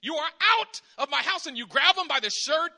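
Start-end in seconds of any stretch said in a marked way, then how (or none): 0.0-1.5 loud, high, furious order; throwing someone out. Pretend. Host to a disrespectful guest, loud and furious. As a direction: throw them out with a yell. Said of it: "You're like".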